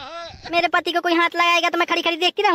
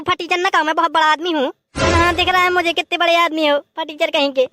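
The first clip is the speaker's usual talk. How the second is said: ordinary delivery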